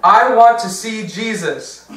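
put-on loud, high, drawn-out demand of a defiant little girl. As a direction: mimic a stubborn child making a demand. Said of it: "and she's defiant".

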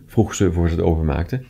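ordinary delivery, nothing marked

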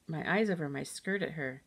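usual speaking voice

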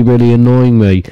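ordinary talk, nothing marked

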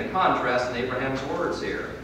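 ordinary delivery

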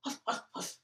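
breathless huffs; panting. Acted. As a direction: puff like you've been running. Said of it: "Bear rushed home".

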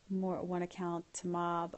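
ordinary delivery

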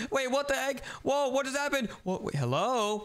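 ordinary delivery

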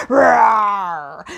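loud, playful roar in the song; dinosaur roar. Acted. Host roars in the song for the children, loud and playful. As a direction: let out a big hammed-up roar. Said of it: "letting out a roar".

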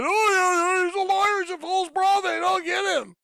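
mocking enemy voice. Preacher mocks the enemy plotting against him, high shrill sneer. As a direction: sneer it out like a gloating devil. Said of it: "the enemy just can't handle it".

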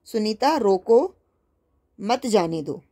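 सुनीता रोको, then a clear break before मत जाने दो; drawn-out रोको, stop, then मत जाने दो; pause after रोको. Shown as a demonstration. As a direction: read it slowly, comma after रोको. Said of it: "ज़रा इसे पढ़ने की कोशिश करें".

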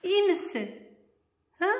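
high-pitched question to a pet; doting pet talk. Real, not acted. Owner checking her pet is well, doting pet talk.

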